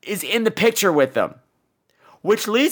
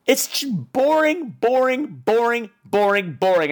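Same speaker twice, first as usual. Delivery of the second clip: slow, fading "boring, boring"; drawn-out boring; 3 semitones higher, slower than usual. Shown as a demonstration. Bored reviewer dragging out how dull the movie was, slow fade. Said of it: "drawn-out repetition".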